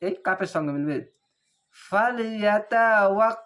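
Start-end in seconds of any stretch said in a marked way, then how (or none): 1.9-3.4 ফালিয়াতা walk recited slowly and louder; careful Quranic reading; slow recitation. Shown as a demonstration. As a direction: draw the phrase out slowly. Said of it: "ফা লাম জবর ফাল".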